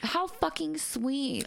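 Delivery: slow, high, gushing; high drawn-out gush. Real, not acted. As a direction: melt over it, drawn out. Gushing over sweet message.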